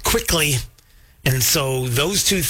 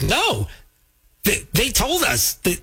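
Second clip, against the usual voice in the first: higher, mock protest of the staff; imitating DMV workers; 8 semitones higher than usual. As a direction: play the indignant workers, pitched up. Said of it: "software work are like".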